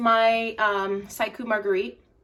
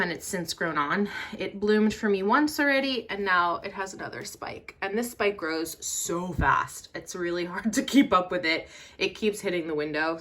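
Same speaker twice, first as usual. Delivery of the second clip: ordinary talk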